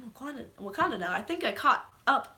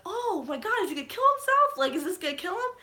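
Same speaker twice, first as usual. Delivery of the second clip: panicked worry; 9 semitones higher, faster than usual. Retold or imitated. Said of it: "I was like".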